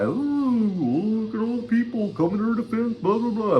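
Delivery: high, sing-song mocking voice of the rival; mocking imitation. Vlogger mocks a rival's smug reply, high sing-song. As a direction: sneer it out like a smug rival mocking his critics. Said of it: "he would respond by".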